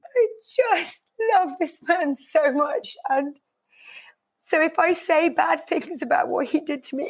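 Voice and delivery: high, tearful voice, laughing through tears; really crying while speaking of her husband. Real, not acted. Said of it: "Oh God, I'm gonna cry".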